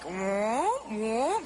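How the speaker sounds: low, drawn-out mock whoa; imitated stretchy superhero. Imitating a superhero saving someone off a cliff, low hero voice. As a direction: play a rubbery hero reaching out, pitched low. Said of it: "he'd be like".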